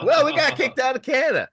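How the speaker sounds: squeaked out high and fast, mock-innocent; high exasperated explaining. Telling border agents why they're walking, high fast sheepish. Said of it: "And we're like".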